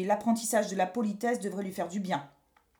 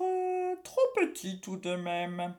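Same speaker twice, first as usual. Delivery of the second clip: plain reading voice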